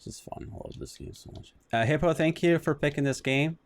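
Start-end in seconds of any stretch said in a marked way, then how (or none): 0.0-1.5 whispered deadpan aside; sarcastic whisper. Real, not acted. Streamer muttering sarcastically about the game, whispered. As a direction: breathe it out, mock enthusiasm.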